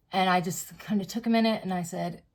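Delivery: ordinary talk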